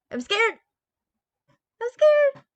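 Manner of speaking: very high, rising squeal; mock scared squeal. Streamer hams up being scared.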